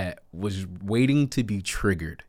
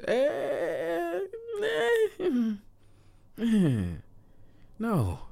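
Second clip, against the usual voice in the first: high whimpering eh eh, then no; fake-cry like a sulking kid; high mock-sob whine of refusal; whiny fake cry; 5 dB quieter, much slower than usual. Whining cry protest.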